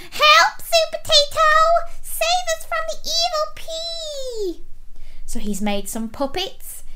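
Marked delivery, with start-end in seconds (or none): 0.1-4.6 very high, squeaky cry for help; piping, pleading falsetto; best veggie voice. A narrator's reading. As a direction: fake a helpless little vegetable calling out. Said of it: "he shouted in his best veggie voice".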